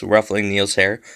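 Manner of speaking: ordinary delivery, nothing marked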